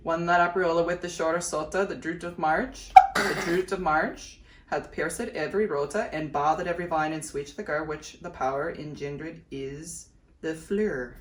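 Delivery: reciting the Canterbury Tales prologue from memory; Middle English recitation. Shown as a demonstration. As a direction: recite it like a memorised school piece, drawing out the old vowels.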